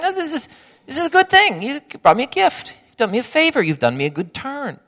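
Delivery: bubbly thanks for a small gift; delighted thanks. Pretend. Host thanking guest for a favor, high gushing delight. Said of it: "You're just saying".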